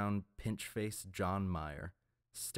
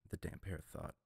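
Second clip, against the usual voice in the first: ordinary delivery, nothing marked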